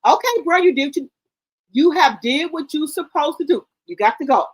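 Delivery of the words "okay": ordinary talk, nothing marked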